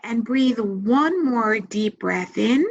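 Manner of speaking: calm, measured meditation voice with long pauses; guided meditation. Acted. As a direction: guide them gently into relaxation, leaving space to breathe.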